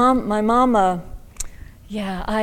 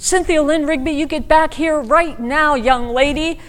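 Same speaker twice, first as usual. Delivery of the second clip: louder, scolding mother calling her back; a mom's retold scold, louder; mock scolding; 7 semitones higher, 5 dB louder than usual.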